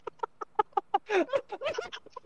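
high eerie giggle imitating a sleepwalking child; a thin spooky little-girl laugh, head thrown back; father imitating his daughter's creepy laugh. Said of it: "she starts laughing".

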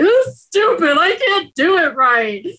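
high, exasperated, slowing on the second line; frustrated whine. Retold or imitated. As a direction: complain high and defeated. Retelling her frustration in therapy.

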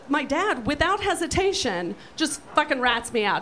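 normal talking voice

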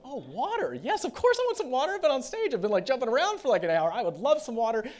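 high, loud, thirsty enthusiasm, acted out; excited shouted acceptance. Sweaty musician gladly accepting water from fans, excited mock shout. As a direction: yell gratefully over the band. Shouting reply over band to girls.

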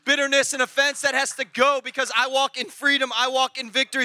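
driving, declarative sermon climax; full-force preaching. Real, not acted. Preacher declaring he walks in freedom, full-force preaching. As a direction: proclaim it with steady force.

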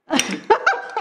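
short high nervous giggle; nervous laugh. Real, not acted. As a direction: let a jittery laugh slip out. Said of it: "Ner-, nervous laugh".

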